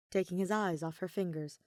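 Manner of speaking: ordinary delivery, nothing marked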